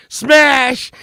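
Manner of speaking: high, punched sound-effect word; crash exclamation. Retold or imitated. Storyteller voicing the car hitting in reverse, explosive crash. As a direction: yell it like the impact itself.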